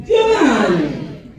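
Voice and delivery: drawn-out shout of the name; rousing call-out. Pretend. Comic leading Fort Myers in a chant, rousing shout. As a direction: lead the crowd in shouting it. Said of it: "say it with me".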